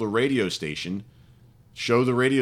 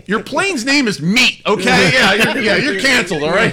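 loud, laughing, higher mock scolding; loud mock accusation; 7 semitones higher, 8 dB louder than usual. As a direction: call them out, laughing.

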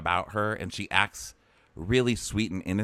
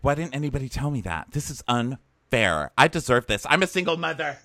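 hammed-up outraged complaint, climbing to the end; mock aggrieved; 6 semitones higher than usual. Imitating a single mom who feels cheated, mock indignant.

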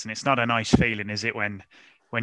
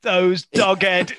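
imitating someone fighting back a swear word, slow and higher; straining self-restraint; 5 semitones higher, 5 dB louder, much slower than usual. Podcast host mimics a ranting fan trying not to swear, straining. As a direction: drag it out, louder, as if holding back the curse. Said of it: "he's trying his hardest".

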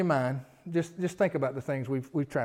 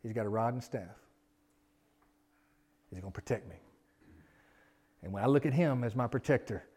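ordinary delivery

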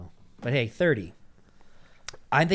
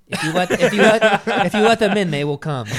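loud, high, laughing through it; 9 semitones higher, 9 dB louder than usual. Real, not acted. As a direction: crack up while saying it. Joking movie line, laughing.

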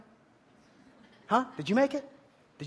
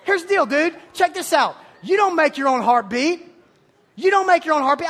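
loud, higher, emphatic shout; shouting preacher; 6 semitones higher, 7 dB louder than usual. Real, not acted. Preacher drives point to students, loud high shout. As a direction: hammer the point home.

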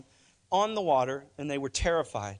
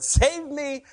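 high, loud desperate cry; yelling for help; 12 semitones higher, 6 dB louder than usual. Acted. Peter sinking, yelling to the Lord for rescue. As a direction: shout it like a sinking man. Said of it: "he yells out to the Lord".

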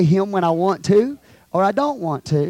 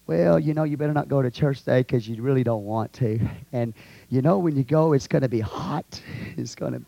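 low, soft coaxing tempter; devil's tempting; 9 semitones lower, 6 dB quieter than usual. Acted. Devil coaxes churchgoer to stay home, low and soft. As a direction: talk them out of it quietly. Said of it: "Next time the devil comes to you".

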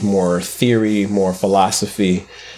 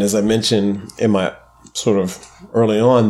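ordinary talk

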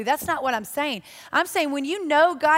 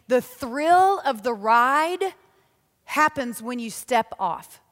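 slowed right down, each word weighed; slow emphasis; 3 dB louder, slower than usual. Shown as a demonstration. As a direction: draw it out slowly for weight. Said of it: "emphatic slow teaching line".

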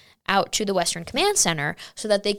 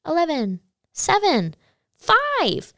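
much higher, giddy countdown; innocent excitement; 10 semitones higher, slower than usual. Retold or imitated. Recalling her giddy countdown to the end of lockdown. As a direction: count down like a thrilled kid. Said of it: "so innocently being like".

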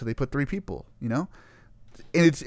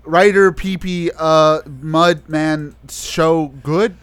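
broken translation read out; 2 semitones higher, 8 dB louder, much slower than usual. Retold or imitated. Reading garbled Google Translate output.

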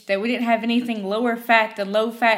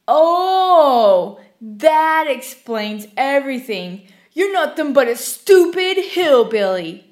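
mocking, condescending stranger, slow and loud; ridiculing customer; 3 semitones higher, 6 dB louder, much slower than usual. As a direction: sneer it down at the hillbilly clerk. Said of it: "a stranger would actually ridicule me".

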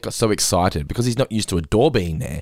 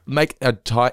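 usual speaking voice

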